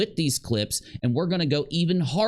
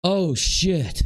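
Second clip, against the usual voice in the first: imitating a shocked outburst; 5 semitones higher than usual. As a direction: act out her startled alarm. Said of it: "And so she gasped".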